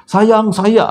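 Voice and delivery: high loud call for his wife; husband shouting for his missing wife, high and loud. Retold or imitated. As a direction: shout across the dark mosque. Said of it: "Dia jerit-jerit".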